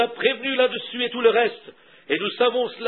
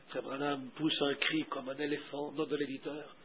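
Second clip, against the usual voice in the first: much lower, softer aside; editor's note; 7 semitones lower, 15 dB quieter than usual. A narrator's reading. Reader inserting an editor's note into the sermon, hushed aside. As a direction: drop right down into a quiet parenthetical read.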